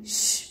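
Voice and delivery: a soft hushing shh to cut him off; shushing. Acted. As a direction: silence him with a quiet shh. Niki silences him. (हळू श्श करून गप्प करणे)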